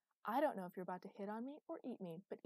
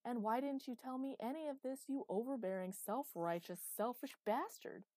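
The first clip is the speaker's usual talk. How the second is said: loud, high, indignant barrage; angry confrontation; 6 semitones higher, 7 dB louder than usual. A narrator's reading. Chloe demanding to know why all this was hidden, angry. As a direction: fire off furious questions, hammering the repeats.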